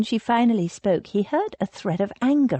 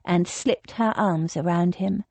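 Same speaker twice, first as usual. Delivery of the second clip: ordinary delivery, nothing marked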